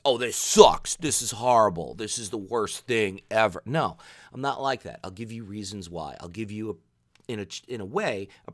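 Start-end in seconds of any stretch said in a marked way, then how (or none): 0.1-3.6 loud, hammed-up mock complaint; mock whining. Mocking players who just bash the game, loud whiny parody.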